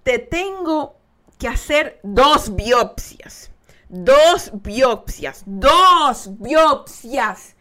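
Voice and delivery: muy lento y más fuerte, arrastrando; doctor, muy lento. Retold or imitated. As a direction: alargar cada palabra con pesar. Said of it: "el doctor me dice". (el doctor anuncia dos biopsias, lento y fuerte)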